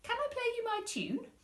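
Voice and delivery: put-on high, quick child voice; eager little boy. Lester asking his mum, high eager child. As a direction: rush it like an excited boy.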